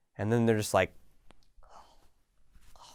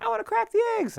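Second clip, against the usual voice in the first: put-on high, squeaky child voice asking to help; very high small-child voice, eager; eager little kid. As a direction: play the kid begging for a turn. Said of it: "they're like".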